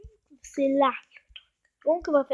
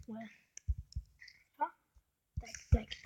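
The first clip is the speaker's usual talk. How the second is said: ordinary delivery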